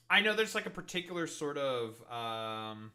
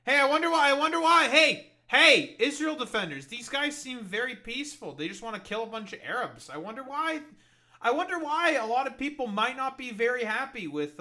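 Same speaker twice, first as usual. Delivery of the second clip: much higher, louder singsong sarcasm; sarcastic taunting; 8 semitones higher, 8 dB louder than usual. Acted. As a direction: needle them with mock wonder. Sarcastic taunt at Israel defenders.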